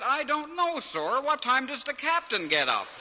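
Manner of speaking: put-on high, loud country-boy voice; naive George. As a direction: ask it wide-eyed and high. George answers the officer.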